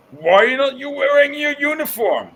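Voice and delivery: loud, high mocking imitation of a boss; exaggerated indignant boss, voice soaring; demanding boss impression. Mimics boss demanding about uniform.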